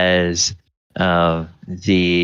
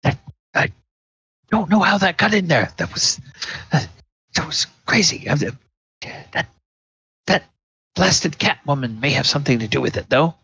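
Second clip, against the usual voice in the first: higher, fast, nervous excuses; flustered husband; 8 semitones higher, much faster than usual. Acted. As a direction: babble defensively, caught off guard. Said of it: "the husband is like".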